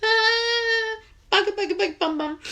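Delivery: high, loud exasperated cursing at herself; mock swearing. Real, not acted. Host scolding herself for crying on her live stream, high and loud exasperated cursing. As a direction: squeal the curses out, embarrassed at the tears.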